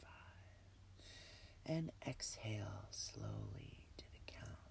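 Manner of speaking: breathy whisper, calm and unhurried; guided meditation. Acted. Leads a relaxation meditation.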